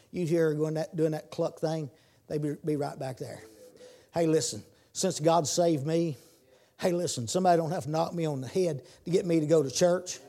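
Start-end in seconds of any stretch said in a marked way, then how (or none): none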